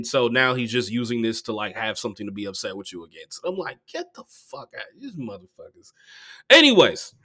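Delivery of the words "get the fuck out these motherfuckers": very soft, dismissive aside; muttered dismissal. Retold or imitated. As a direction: curse them off under your breath. Acting out dismissive reaction.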